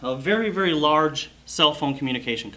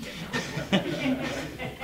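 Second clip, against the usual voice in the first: airy laugh; 6 semitones higher, 7 dB quieter than usual. Real, not acted. Laughs at audience member.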